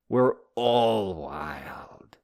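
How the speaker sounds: drawn out and slow, voice changed; ending the fart lady moral, drawn out slowly; slow punchline. Acted. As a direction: savor the final words.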